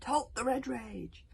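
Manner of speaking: drawn-out commander's shout; commanding call. A narrator's reading. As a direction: call a halt across an army. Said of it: "called the mighty East".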